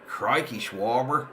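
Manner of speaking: mock Aussie "Crikey, Schwarber" with a light Australian twang; Australian accent. Shown as a demonstration. Streamer says Schwarber for chat in an Australian accent. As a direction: play a cheeky Aussie saying "Crikey, Schwarber". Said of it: "say Schwarber in an Australian accent".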